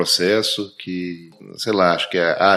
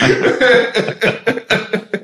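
laughing; 6 semitones higher, 5 dB louder than usual. Real, not acted. Laughs at own punchline.

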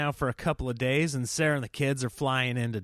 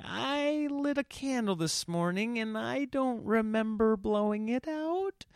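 high, slow, soft confessing voice; imitated sheepish wife; 8 semitones higher, 4 dB quieter than usual. Imitating wife admitting a candle mistake, sheepish and high. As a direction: tiptoe through the admission, high and drawn out.

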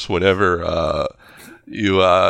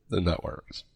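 whispering; 11 dB quieter than usual. Real, not acted. Whispering aside.

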